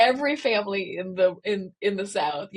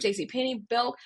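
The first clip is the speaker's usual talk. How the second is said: normal talking voice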